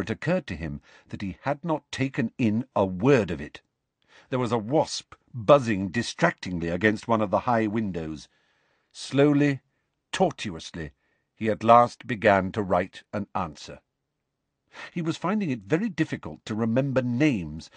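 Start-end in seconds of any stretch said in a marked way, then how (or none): none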